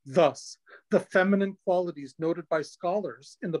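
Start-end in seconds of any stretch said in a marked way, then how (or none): none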